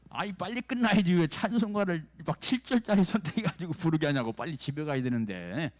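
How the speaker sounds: high, loud mock whining at the pastor; imitated irritated complaint. Churchgoer wanting to get home over long hymns, irritated mock complaint. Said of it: "짜증나게".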